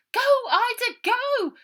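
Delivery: very high, loud urging yell; shouted. Acted. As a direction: scream at her to floor it. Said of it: "shouted Brian from the back".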